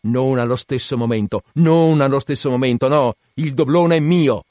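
loud, building contradiction; captain loudly claiming his prize; forceful insistence. A narrator's reading.

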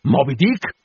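sharp rise in pitch and volume; cried question. A narrator's reading. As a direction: cry the name out as a question. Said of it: "gridò Acab".

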